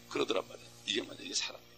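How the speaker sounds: whispered, very soft revelation; hushed emphasis. Real, not acted. Preacher hushing to reveal that this is love. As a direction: drop to a whisper.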